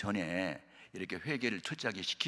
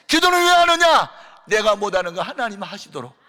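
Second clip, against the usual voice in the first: much higher and louder, shouted sermon cries; fiery preaching; 10 semitones higher, 14 dB louder than usual. Acted. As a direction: preach it at the top of your voice. Preaching climax.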